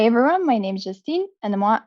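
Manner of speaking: ordinary talk, nothing marked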